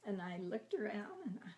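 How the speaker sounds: ordinary talk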